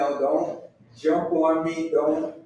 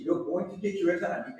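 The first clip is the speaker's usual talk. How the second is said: ordinary talk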